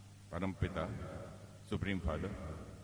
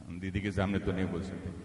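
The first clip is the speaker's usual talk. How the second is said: normal talking voice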